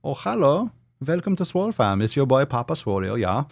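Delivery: put-on high, camp German accent; German or Austrian accent. Host welcoming a new member, in camp German accent.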